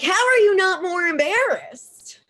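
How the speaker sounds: high, loud incredulous demand; appalled outburst. Retold or imitated. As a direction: cry out in disbelief, pitched right up. Acts out her appalled reaction.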